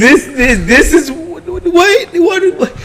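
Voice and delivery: spluttering confused what; stunned disbelief. Real, not acted. Reacting to book images.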